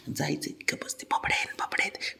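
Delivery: breathy whisper to the cat; urgent hushed whisper to a pet. Real, not acted. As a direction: whisper so the sleepers don't stir. Whispering so sleepers stay asleep.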